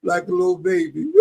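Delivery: crying out high and fast; mock baby crying; retold crying. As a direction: break into a childlike wail. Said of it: "I started crying".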